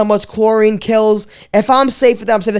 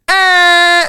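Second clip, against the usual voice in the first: loud, higher mock buzzing drone noise; 7 semitones higher, 4 dB louder than usual. A loud imitated drone buzzing overhead. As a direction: make the drone whine grow.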